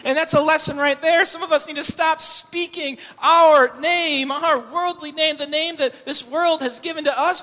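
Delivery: loud, high impassioned preaching; full-force preaching. Acted. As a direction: drive the message home with fire.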